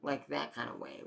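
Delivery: ordinary talk, nothing marked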